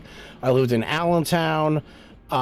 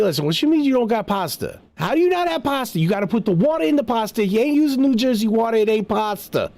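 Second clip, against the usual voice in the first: put-on fast, high-pitched Italian New Jersey accent; 7 semitones higher, faster than usual. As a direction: argue indignantly in a rapid Italian-Jersey accent. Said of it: "like, the Italian jerseys".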